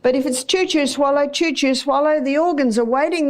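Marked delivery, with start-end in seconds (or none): none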